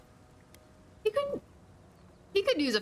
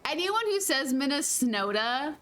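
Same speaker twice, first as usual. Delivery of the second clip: normal talking voice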